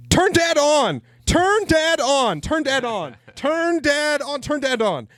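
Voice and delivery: high, drawn-out tantrum screech; imitated toddler scream. Father mimicking his daughter's tantrum over a fan, screaming. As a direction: yell it like a screaming two-year-old. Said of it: "she just starts screaming, yelling at me".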